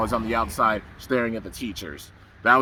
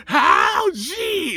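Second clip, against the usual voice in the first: very high, strained imitated shout; acted transformation cry; 4 dB louder than usual. Fan acts out the doctor turning into Hal G, high cry. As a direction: stretch the name into a high yell.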